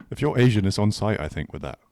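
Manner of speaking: ordinary delivery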